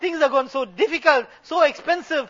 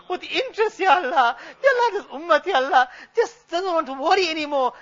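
crying lament; 3 semitones higher than usual. Real, not acted. Imam lamenting the ummah to Allah, crying. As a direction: grieve over the ummah's indifference.

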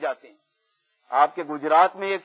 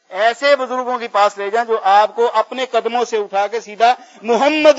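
loud, raised sermon exhortation; forceful preaching; 2 semitones higher, 7 dB louder than usual. Real, not acted. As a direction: urge the congregation with fervour. Preaches the moral at full force. (پرجوش خطیبانہ نصیحت)

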